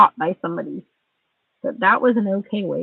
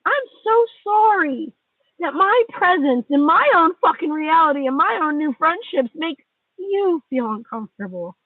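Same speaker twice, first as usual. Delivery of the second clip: sarcastic apology; 8 semitones higher than usual. Real, not acted. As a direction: apologise without meaning a word of it. Fake apology to the friend.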